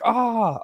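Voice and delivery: short, high-pitched yelp of shock; retold cry of surprise; startled yelp. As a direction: yelp as if suddenly zapped.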